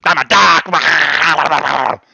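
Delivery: slow, loud shouted declaration. Real, not acted. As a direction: bellow it out, dragging the words. Ranting at an unseen audience.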